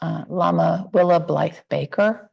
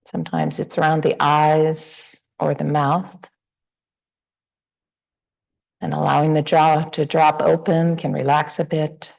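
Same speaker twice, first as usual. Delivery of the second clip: unhurried meditation guidance with long pauses; guided meditation. Real, not acted. Teacher guiding the group to relax into the body, guided meditation. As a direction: ease listeners gently through a body scan, leaving space between phrases.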